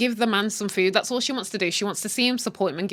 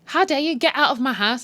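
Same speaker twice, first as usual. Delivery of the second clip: mock outrage; 6 semitones higher, 3 dB louder than usual. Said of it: "she's like".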